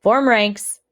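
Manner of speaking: sharp, loud call to form ranks; barked order. Acted. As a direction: call it out across the yard. Order called out.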